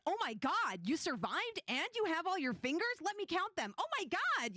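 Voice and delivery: high-pitched amazed outburst in own voice; excited, no accent. Shown as a demonstration. NPC greeting surviving adventurers, excited without the accent. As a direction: gush with astonishment, accent left off. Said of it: "without having the voice chops for it".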